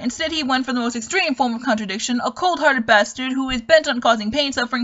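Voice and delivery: normal talking voice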